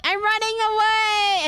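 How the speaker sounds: high, loud, slow mock-panicked cry; panicked yell. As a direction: wail it out like you're fleeing a dog. Fleeing the dog in the story.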